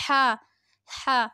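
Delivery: "ہا" with a strong breathy throat h. Shown as a demonstration. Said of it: "اسے حلق سے ادا کیجیے".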